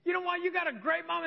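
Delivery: high-pitched mock shout from a crowd; calling out. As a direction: call out cheerily as an imitated onlooker, pitched high. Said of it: "just call out and say".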